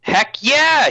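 enthusiastic loud heck yeah; excited. Real, not acted. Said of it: "censoring myself".

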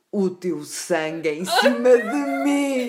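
milk the pause, then loom; hammy stage actor. Acted. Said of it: "depois de uma pausa grande ele responde".

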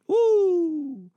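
high, loud joyful whoop; a preacher's happy shout; excited woo. Real, not acted.

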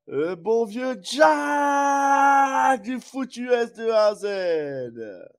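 slow announcer build, ending in a high shout; fighting game announcer. Acted. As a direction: hype the challenger like a fight night host. Introducing the challenger boxing style.

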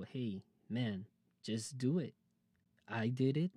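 ordinary talk

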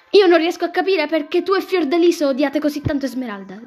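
high, loud protest; exasperated. Acted. Nephew challenges his aunt about her hatred, exasperated.

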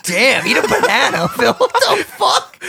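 high, loud jeering viewer; mocking commenter voice. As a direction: laugh it out as a heckler.

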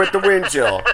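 lightly parodied weather presenter; weather forecaster. Retold or imitated. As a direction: tack on a forecast detail. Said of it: "I hear your reports on our show".

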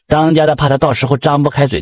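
ordinary delivery, nothing marked

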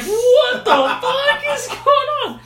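high, loud, slow laughing cry of shock; drawn-out amazed yelp; stunned disbelief. Retold or imitated. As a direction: react to the sudden time jump. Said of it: "I'm like".

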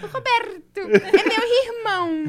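"Roberto" and "irmão" with a throaty carioca R, slow and high. Retold or imitated. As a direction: show off the child's rolled carioca R. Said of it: "Quando ela fala o R assim". ("irmão" com R carioca, devagar e mais agudo)

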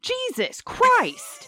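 high, drawn-out exasperated cry; exasperated outburst. Retold or imitated. Viewer fed up with a whining contestant, exasperated. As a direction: stretch it out, totally fed up.

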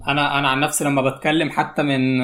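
ordinary delivery

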